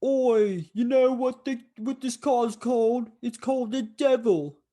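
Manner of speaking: high, loud put-on London geezer voice; Horace's mock Cockney. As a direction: ham up a cheeky Cockney bloke calling up from the car. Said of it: "and he's like".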